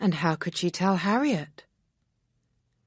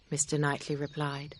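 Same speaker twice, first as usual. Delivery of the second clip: usual speaking voice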